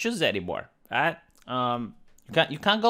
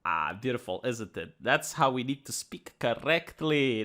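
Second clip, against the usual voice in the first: much softer and lower, sarcastically dreamy; mock bliss; 8 semitones lower, 8 dB quieter than usual. Sarcastic host savoring the correct new term, mock reverent hush. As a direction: sigh it out as if enchanted.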